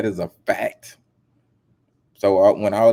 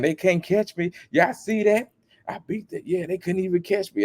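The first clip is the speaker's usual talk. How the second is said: high, gloating singsong brag; mock bragging R. Kelly; 9 semitones higher than usual. Mocking a celebrity bragging on video, high gloating voice. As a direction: crow about getting away with it. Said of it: "he was even on video saying".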